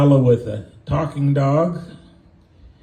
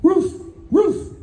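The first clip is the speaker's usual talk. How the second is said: high, short woofs shaped as words; talking dog. Acted. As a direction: bark it like a comic dog. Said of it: "And the dog says".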